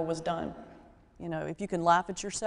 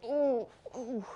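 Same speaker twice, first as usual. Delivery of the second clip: mock strained groan of effort, softer; straining groan; 5 semitones higher, 5 dB quieter than usual. Injured witness strains to lift his arm, mock groan. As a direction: grunt like lifting the arm hurts. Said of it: "great effort".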